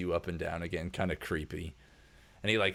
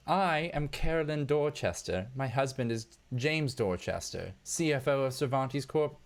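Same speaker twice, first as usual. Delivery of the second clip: grand socialite; 7 semitones higher than usual. Acted. Wife introduces herself grandly.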